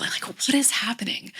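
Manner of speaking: a whispered, playfully wondering question; whispered talking turtle. Pretend. Coach voices the sea turtle watching symptoms, whispered wonder. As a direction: hush it like a curious turtle. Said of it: "now you're this talking sea turtle".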